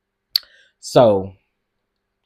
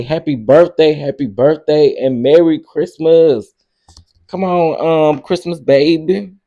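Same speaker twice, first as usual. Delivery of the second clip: louder, higher happy birthday calls; loud birthday wishes; 5 semitones higher, 8 dB louder than usual. Real, not acted. Wishing a viewer on her fifty-ninth birthday happy birthday out loud.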